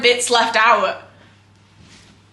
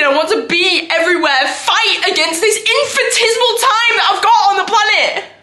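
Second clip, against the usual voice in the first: raised pitch, urgent self-defence; impassioned outburst; 6 semitones higher than usual. Acted. Actor defends herself to the group, impassioned and raised. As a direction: build to a desperate, pressing declaration.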